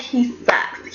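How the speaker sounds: he sucks, dropped to a breathy whisper; a conspiratorial whispered jab; whispered aside. Real, not acted. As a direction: lean in and whisper the put-down. Repeats the insult after a laugh.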